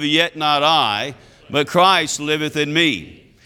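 normal talking voice

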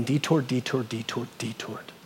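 "detoured" said again and again, fading to almost nothing; fading repetition. Shown as a demonstration. Warning the congregation, detoured fading away. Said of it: "repetition fading out".

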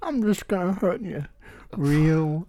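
lightly voiced menacing Joker; a hint of a creepy villain. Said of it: "That's not even an accent".

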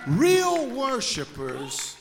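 slow, drawn out real worshipers, pitched high; real worshipers dragged out high and loud; emphatic. Acted. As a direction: lean hard on real and stretch it. Slow emphatic sermon line.

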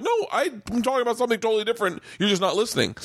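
fast rant. Retold or imitated. Retold defensive rant.

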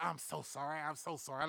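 soft, imitated embarrassed apology; hushed apology. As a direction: mimic a parent apologizing under their breath. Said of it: "She even threw in a couple of".

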